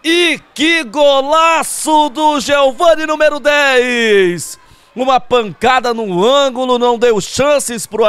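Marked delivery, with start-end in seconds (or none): 0.0-4.6 high loud goal roar; explosive goal commentary. Real, not acted. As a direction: scream the goal, then stretch it. Goal scored live commentary.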